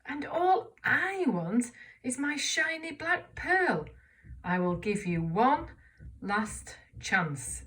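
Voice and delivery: slow, loud and high, cross and demanding; angry storybook voice; angry caterpillar. Shown as a demonstration. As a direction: read it like a furious caterpillar. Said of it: "have a go at reading that with me".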